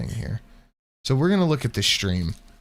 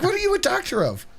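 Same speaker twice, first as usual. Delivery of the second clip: much higher, fast, giggly question; laughing high question; faster than usual. Retold or imitated. Friend asking what doctor she is, high and laughing. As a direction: squeak it out laughing. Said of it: "She's like".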